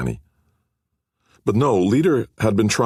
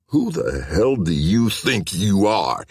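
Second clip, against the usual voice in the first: bullying anger; 2 semitones higher, slower than usual. A narrator's reading. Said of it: "the bully in him at last coming out".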